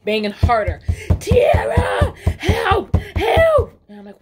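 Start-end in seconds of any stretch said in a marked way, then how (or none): 1.2-3.7 high, loud desperate calling through a door; shrill panicked plea at the door; frantic cry for help. Retold or imitated. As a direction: yell for help like a panicked teen. Daughter banging, calling for help.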